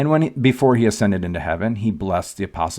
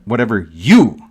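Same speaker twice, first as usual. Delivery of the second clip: you in Whatever you hit louder; stress on you; 7 dB louder than usual. Shown as a demonstration. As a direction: hammer the you. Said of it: "You notice all the yous?".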